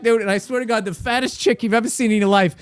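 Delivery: ordinary delivery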